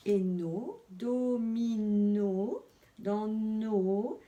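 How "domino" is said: "domino" slowly stretched into three beats; "domino" sounded out for young readers; syllable by syllable. Shown as a demonstration. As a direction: hold each syllable of "domino": do, mi, no. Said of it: "deuxième syllabe".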